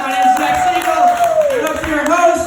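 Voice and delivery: high, fast hollered farewell; big high-pitched goodbye to the crowd; shouted sign-off. Real, not acted. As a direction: yell your name to close the show.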